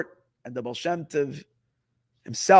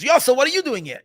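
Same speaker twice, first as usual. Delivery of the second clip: louder, higher startled cry to his son; surprised call; 7 semitones higher, 6 dB louder, faster than usual. Retold or imitated. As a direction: call out the name in shock. Father surprised seeing his son.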